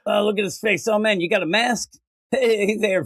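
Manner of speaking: high, chummy farmhand voice greeting a masked stranger; mock friendly farmhands. Farmhands greeting the Scarecrow, mock cheerful high voice. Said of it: "And they're like".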